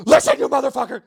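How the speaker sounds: hammed-up raging addict yelling at the new guy; furious shout. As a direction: bellow it like a man demanding a hug by force. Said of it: "he grabs him by the neck and he's like".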